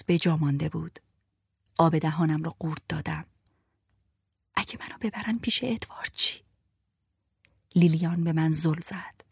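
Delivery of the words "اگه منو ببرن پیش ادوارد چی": fearful whisper. Acted.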